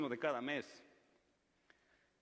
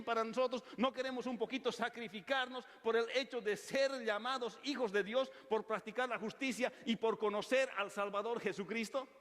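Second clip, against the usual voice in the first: higher, driving preacher's exhortation; fervent preaching; 6 semitones higher than usual. Acted. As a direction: rouse the congregation, push every line up.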